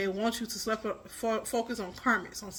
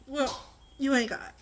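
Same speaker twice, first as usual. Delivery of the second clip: sassy, a bit higher; sassy retort; 3 semitones higher than usual. Real, not acted. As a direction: tell them off with sass. Retort to imagined foes.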